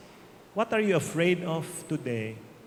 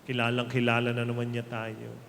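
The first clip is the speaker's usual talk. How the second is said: ordinary talk, nothing marked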